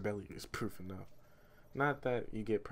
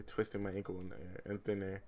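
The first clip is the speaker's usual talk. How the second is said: usual speaking voice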